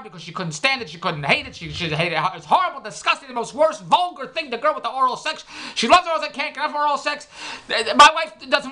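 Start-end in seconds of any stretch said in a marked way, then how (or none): none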